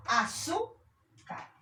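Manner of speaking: "azúcar" slowly, high rising "zú", pause, then "car"; stressed-syllable demonstration. Shown as a demonstration. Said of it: "penúltima sílaba".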